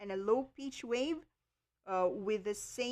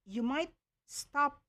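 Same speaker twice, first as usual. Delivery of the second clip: ordinary talk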